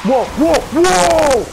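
loud, rising alarmed whoas; startled whoas. Real, not acted. Gamer startled by a screaming zombie, loud whoas. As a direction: caught off guard, yelp it out.

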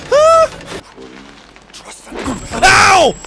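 high, loud cries of fright; shriek, then yelp; startled screams. Real, not acted. Attacked in horror game.

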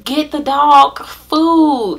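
can't believe it happened; stunned disbelief. Retold or imitated. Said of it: "And I was like".

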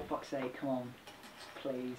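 soft, low muttered pleading; quiet dread. Real, not acted. Dreading the next bean.

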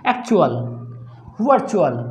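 "एक्चुअल, वर्चुअल" each with a brief chu; don't stretch the u in "एक्चुअल, वर्चुअल"; "एक्चुअल, वर्चुअल" one at a time, short u. Shown as a demonstration. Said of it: "बहुत short बोलना है".

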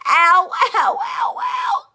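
loud high yelps of pain; hurt yelps after a fall; ow cries. A narrator's reading. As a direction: yelp each ow. Bangs head on desk.

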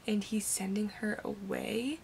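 soft whispered dismay; upset whisper. Real, not acted. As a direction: hush it out, close to tears. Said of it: "I think I'm about to cry".

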